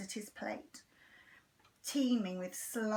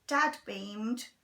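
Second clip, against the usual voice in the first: usual speaking voice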